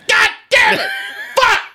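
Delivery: strained mock cursing outburst; hammed-up cursing fit; angry husband. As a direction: parody your own rage in short bursts. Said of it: "a mental image of me".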